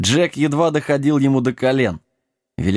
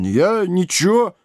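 raised-pitch sheepish backing down; embarrassed; 6 semitones higher than usual. A narrator's reading. Giant agrees to drink, embarrassed. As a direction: give in, flustered. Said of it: "смутился великан".

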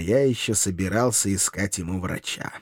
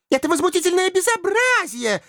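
very high, thin little voice; indignant river-maiden squeal; thin-voiced outcry. A narrator's reading. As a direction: wail in outrage in a tiny high voice. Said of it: "тонкими голосками возопили две речные жительницы".

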